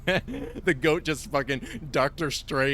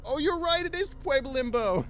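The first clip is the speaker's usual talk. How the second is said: much higher, bright mock excitement; mock high squeaky voice; 10 semitones higher, 4 dB louder than usual. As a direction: squeak like a giddy kid.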